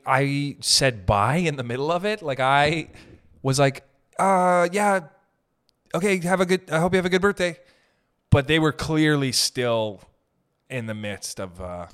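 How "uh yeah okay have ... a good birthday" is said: higher, forced polite sign-off; awkward hurried goodbye. Retold or imitated. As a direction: sound eager to escape. Awkwardly wrapping up a chat.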